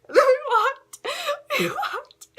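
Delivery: high, loud, helpless laughter through the words; real giggling fit breaking up the sentence; cracking up. Real, not acted. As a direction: squeal it out while laughing. Cracking up retelling shared joke.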